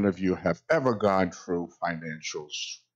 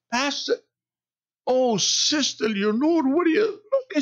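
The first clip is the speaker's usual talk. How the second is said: very high, louder, caught-out yelp; startled squeal; 3 dB louder than usual. Retold or imitated. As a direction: shriek it like someone caught half dressed. Pastor voices his own startled self.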